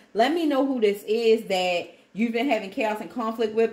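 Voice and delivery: ordinary talk, nothing marked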